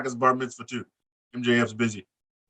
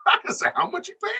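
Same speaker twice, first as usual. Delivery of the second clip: high, whispery put-on voice, slowed; 9 semitones higher than usual. Mocking the promo line in his funny voice, high and slow. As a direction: squeeze it up into a silly high voice. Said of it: "The voice back on".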